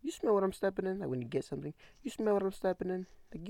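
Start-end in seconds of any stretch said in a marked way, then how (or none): 0.0-1.0 higher, cocky catchphrase question; catchphrase delivery. Shown as a demonstration. Showing how he always says the catchphrase, cocky. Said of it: "I really say it all the time". 2.0-3.1 higher, cocky catchphrase question; catchphrase delivery. Shown as a demonstration. Showing how he always says the catchphrase, cocky. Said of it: "I really say it all the time".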